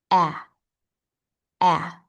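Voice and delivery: "ah" as the open vowel in apple; short A sound. Shown as a demonstration. Teacher demonstrating vibration in the A sound, short A sound. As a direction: say "ah" twice, short and separate, mouth spread. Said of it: "make a little smile to create this sound".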